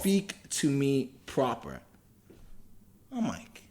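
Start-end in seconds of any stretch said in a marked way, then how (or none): 0.0-1.8 put-on slow, deliberate Yoruba-accented order; imitated Yoruba accent. Soldier pointing a rifle at a boy, slow imitated Yoruba accent. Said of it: "thick Yoruba accent".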